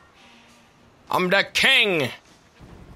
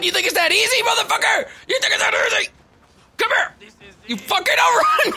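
furious shout; 9 semitones higher than usual. Real, not acted. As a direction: scream abuse at the brawler. Brawling with NPC, cursing.

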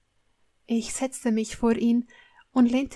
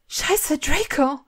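higher, louder cry of sudden realisation; acted jolt of dismay; alarmed exclamation; 5 semitones higher, 4 dB louder, slower than usual. A narrator's reading. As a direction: blurt it out in alarm.

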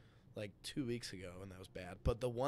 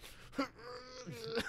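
high strained grunt under the weight; mock lifting strain; straining groan; 13 semitones higher than usual. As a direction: groan like lifting a heavy bar. Straining on the third rep.

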